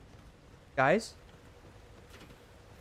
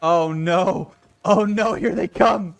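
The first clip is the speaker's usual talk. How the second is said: alarmed; 4 semitones higher, 7 dB louder, slower than usual. Real, not acted. Enemies approaching.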